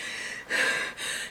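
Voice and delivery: heavy, airy exhale to show breathlessness; out-of-breath sigh. Shown as a demonstration. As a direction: let out a winded puff of breath. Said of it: "I have been running out of breath".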